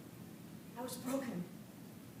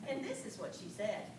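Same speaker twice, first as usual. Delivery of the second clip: ordinary talk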